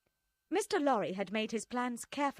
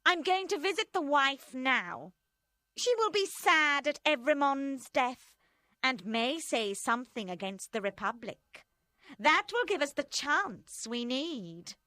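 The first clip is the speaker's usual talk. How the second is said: higher, louder cold voice; scheming Madame Defarge; 5 semitones higher, 4 dB louder than usual. A narrator's reading. Madame Defarge telling friends her plan, cold and loud. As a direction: plot it out coldly.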